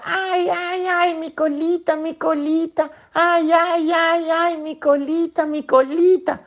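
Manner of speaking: put-on whimpering baby calling for help; crying out in pain. Trapped baby otter wailing about her squashed tail, high. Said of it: "Y gritaba".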